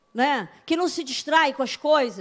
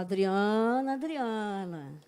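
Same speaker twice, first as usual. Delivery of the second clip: lower, slow, softly calling her name; tender calling; 6 semitones lower, 3 dB quieter, much slower than usual. Retold or imitated. God gently calling the preacher by name. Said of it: "Ele fala".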